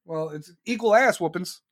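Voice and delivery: ordinary talk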